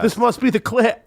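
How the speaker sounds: high silly put-on voice; mock voice. Joking put-on voice.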